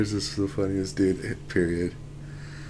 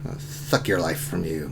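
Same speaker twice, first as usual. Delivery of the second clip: villain; 7 semitones lower, 6 dB quieter than usual. Acted. Acting a villain in game.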